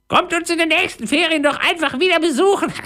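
very high squawked farewell; parrot voice. A narrator's reading. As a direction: screech the invitation like a parrot. Parrot calls farewell to pirates. (hoch krächzende Papageienstimme)

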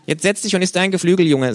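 high, loud cheeky kangaroo greeting. Acted. The kangaroo scolds the late narrator in its high cheeky voice. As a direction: snap it out as the brash kangaroo.

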